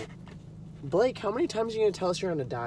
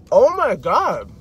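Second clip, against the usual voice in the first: delighted; 4 semitones higher, 7 dB louder than usual. Real, not acted. Savoring pizza.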